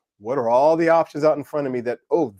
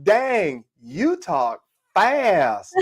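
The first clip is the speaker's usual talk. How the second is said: put-on higher, drawn-out Southern kid voice; fifth-grade girl; 5 semitones higher, much slower than usual. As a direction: imitate an amazed little girl, stretching the words.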